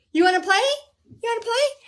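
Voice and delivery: excited high-pitched puppy voice; playful dog talk. Real, not acted. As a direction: egg the dog on to play. Talking to her playful dog.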